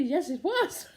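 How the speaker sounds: normal talking voice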